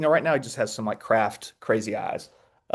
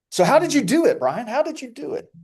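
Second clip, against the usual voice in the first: playful question; 10 semitones higher than usual. Pretend. Reading viewer questions playfully.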